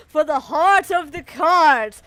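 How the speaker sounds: loud, slow, dramatic declaration; hammed-up heroic cry. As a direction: declare it with mock grandeur. Hammed-up quote within the narration.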